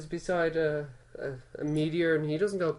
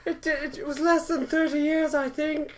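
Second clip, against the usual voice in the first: hammy villain voice; 10 semitones higher than usual. Retold or imitated. Voice held from the bit.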